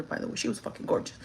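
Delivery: breathy whispered aside, quick. Real, not acted. Aside to viewers about the gorgeous bartender, whispered. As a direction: confide it under your breath.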